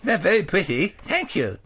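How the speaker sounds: a lightly voiced schoolboy; little boy John. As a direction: play the good little boy.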